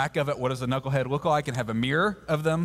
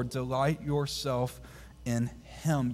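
slow and softer, reverent quote; slow soft scripture; 3 semitones lower, 4 dB quieter, slower than usual. Shown as a demonstration. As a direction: draw it out gently and slowly. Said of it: "David says".

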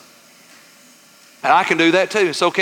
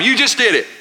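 usual speaking voice

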